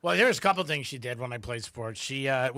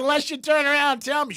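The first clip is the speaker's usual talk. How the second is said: mom teasing; 12 semitones higher, 5 dB louder than usual. Retold or imitated. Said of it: "She goes".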